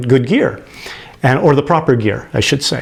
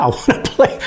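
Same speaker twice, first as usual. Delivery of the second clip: laughing excitement; 12 semitones higher, 3 dB quieter than usual. Retold or imitated. Excited teen asked to play.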